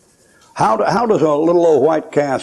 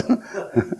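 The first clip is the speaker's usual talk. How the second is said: short laugh; 8 semitones higher, 7 dB quieter than usual. Real, not acted. Laugh after punchline.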